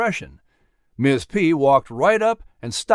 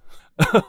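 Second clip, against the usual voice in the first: short, raised character chuckle; 4 semitones higher than usual. A narrator's reading. Jack amused by the apple, short chuckle. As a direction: chuckle briefly before speaking. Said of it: "Jack said with a chuckle".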